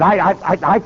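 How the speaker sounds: imitated stuck stutter on I; stammering. Sweating governor searching for words, stammering. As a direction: get stuck repeating the first word. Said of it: "the governor is stammering and stuttering".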